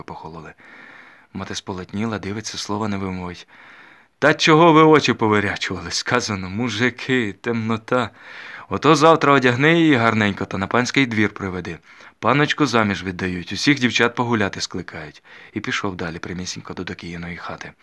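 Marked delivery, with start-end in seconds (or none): 4.2-15.2 1 contemptuous overseer. A narrator's reading. Overseer scolding the peasants.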